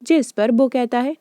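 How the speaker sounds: plain reading voice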